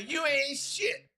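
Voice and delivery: high, slow mock taunt; retold hollering. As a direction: imitate a jeer without real volume. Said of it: "you screaming at the top of your lungs".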